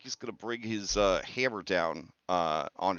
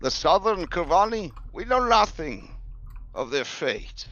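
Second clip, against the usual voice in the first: raised, solemn prophetess; seer woman voice; 8 semitones higher than usual. Acted. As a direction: speak prophecy, slow and grave. GM voicing Dula.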